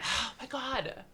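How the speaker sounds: high, tearful gasp of disbelief; real crying. Real, not acted. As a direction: talk through sudden tears, pitch way up. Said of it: "Why am I crying?".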